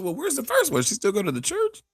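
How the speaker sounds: very high mock-incredulous imitation; comedian probing pastor. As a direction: imitate a comic pouncing with questions.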